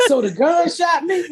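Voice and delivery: high, laughing disbelief rising at the end; incredulous question. Retold or imitated. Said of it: "I said, The gun shot me".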